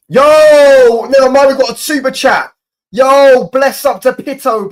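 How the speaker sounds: loud, high-pitched burst of real joy; hyped streamer shout with a long opening cry; excited shouting. Real, not acted. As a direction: yell out a thrilled thank-you to a donor. Streamer suddenly gets a super chat.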